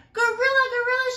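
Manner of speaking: high, loud frightened squeak; squeaky panic. A narrator's reading. Mouse squeaking for help at the gorilla. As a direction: squeak it out in terror. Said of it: "she squeaked".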